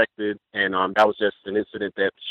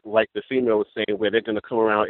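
ordinary delivery

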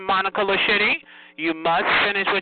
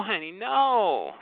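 slow drawn no; 2 semitones higher, 5 dB quieter, much slower than usual. Real, not acted. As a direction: drag the refusal out slowly. Scolding a rude caller.

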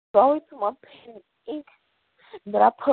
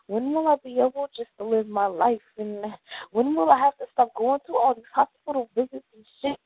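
ordinary talk

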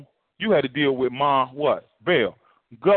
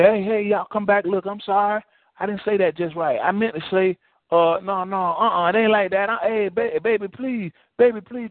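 high-pitched hammed-up pleading; mock begging; 7 semitones higher than usual. Preacher mocks Jesus begging deserters back, hammed-up high pleading. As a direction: play a desperate lover begging them to come back.

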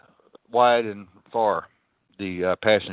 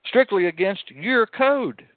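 heated retort; 11 semitones higher than usual. Retold or imitated. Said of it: "I said".

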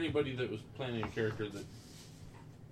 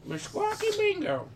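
bird voice; 4 dB louder than usual. Acted. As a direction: play the bird, squawking. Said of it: "your bird makes a sound".

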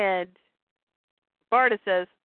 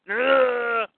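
long acted groan of a struck guard; pained grunt. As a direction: groan as if taking a hit. Guard is hit in acted fight.

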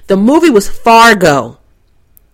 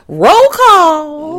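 sing-song call-out; 6 semitones higher than usual. Real, not acted. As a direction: roll call sung out high, rising. Podcast segment call-out.

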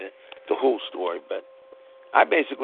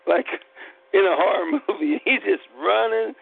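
real laughter breaking through the words, pitched up; can't stop laughing at the memory; giggling high while telling it; 8 semitones higher than usual. Real, not acted. Laughing while retelling the chase.

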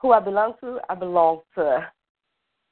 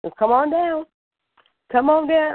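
much higher, louder come-on-down call; excited call; 11 semitones higher, 3 dB louder than usual. Real, not acted. Guest excitedly invites radio callers, high and loud. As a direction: call them in like a game-show host.

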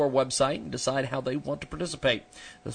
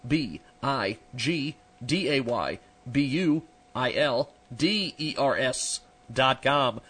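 slow, letter by letter spelling; spelled out slowly; slower than usual. Shown as a demonstration. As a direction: spell each letter out clearly. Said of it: "We're gonna spell it for you".

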